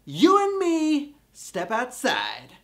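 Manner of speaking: slow, hammed-up fight challenge; mock tough challenge. Host challenges viewers who won't click, mock-tough.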